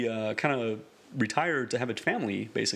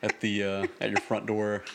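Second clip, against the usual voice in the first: ordinary talk, nothing marked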